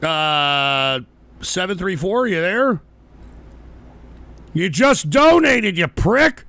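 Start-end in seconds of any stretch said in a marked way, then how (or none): 4.5-6.4 exasperated yell. Real, not acted. Caller donated but stays silent.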